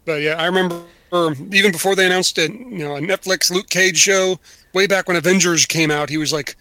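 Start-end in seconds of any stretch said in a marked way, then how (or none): none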